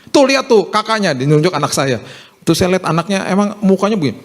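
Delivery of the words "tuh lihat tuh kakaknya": high-pitched mock nagging; irritated parent, pitched up; imitated scolding father. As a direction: act out a dad pointing out a better example. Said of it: "dia marah-marah sama anaknya".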